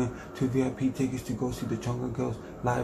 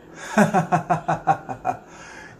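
high laugh; 4 semitones higher than usual. Acted.

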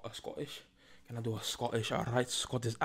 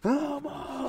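very high, loud held notes; high-pitched outburst; 14 semitones higher, 7 dB louder than usual. Pretend. Host clowning for viewers, piercingly high mock outburst. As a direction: leap up high and hold it loudly.

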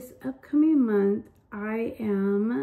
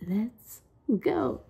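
normal talking voice

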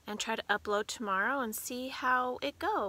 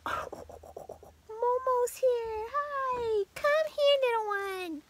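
pet talk; 12 semitones higher, much slower than usual. Real, not acted. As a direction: coax the puppy closer. Greeting dog Momo.

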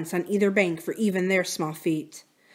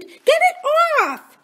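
very high, slow, drawn-out shriek; panicked scream; 6 dB louder, much slower than usual. A narrator's reading. Drew shrieks at the others to get it off, terrified. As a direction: beg in terror for help. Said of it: "Drew screamed".

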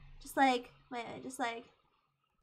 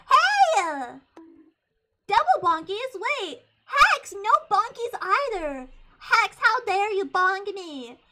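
loud, higher, playfully indignant; mock outrage; 4 semitones higher, 7 dB louder, slower than usual. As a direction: scold them with hammed-up offence. Mock scolding viewers for bonking.

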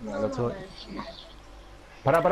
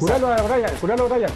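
high, urgent repeated call; urgent plea; 7 semitones higher than usual. Real, not acted. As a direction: beg them to heal him fast. Pide curar al compañero. (pedido urgente y agudo)

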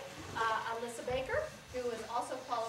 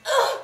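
loud, high gasp of pain; sharp stage death groan; stabbed gasp; 14 semitones higher, 17 dB louder than usual. Acted. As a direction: gasp as the blade goes in. Juliet stabs herself.

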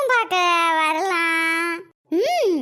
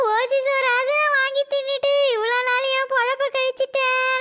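usual speaking voice

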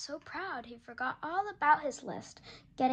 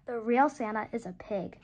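ordinary delivery, nothing marked

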